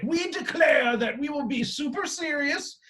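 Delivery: high, far-off mock Shakespearean declaration. Wrestling fan mocking a blood-oath promise, hammed-up Shakespearean. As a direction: proclaim it like an old Shakespeare player. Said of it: "I don't know why I got a Shakespeare accent".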